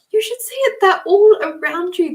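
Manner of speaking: very high, thrilled rush; bursting to share what she sees; excited. Retold or imitated. Telling the Canadian client excitedly that fairies surround her.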